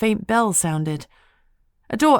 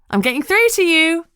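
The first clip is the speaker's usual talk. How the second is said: higher and louder, gleeful win; triumphant; 12 semitones higher, 6 dB louder than usual. A narrator's reading. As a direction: crow it like you've finally won the argument. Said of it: "Lucy said triumphantly".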